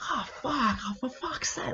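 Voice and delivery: high, strained frustrated cursing; mock an upset surfer missing a wave. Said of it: "he's like".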